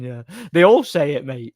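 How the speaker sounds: usual speaking voice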